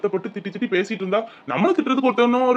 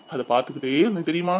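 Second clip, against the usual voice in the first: ordinary delivery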